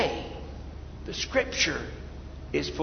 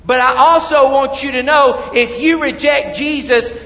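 loud, high-pitched sermon warning; forceful preaching; 7 semitones higher, 8 dB louder than usual. Real, not acted. Preacher warns those who reject Jesus, forceful preaching. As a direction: preach it out at full volume.

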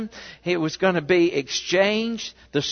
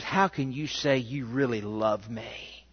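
soft reproach; 5 semitones lower, 7 dB quieter than usual. A narrator's reading. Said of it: "she challenges".

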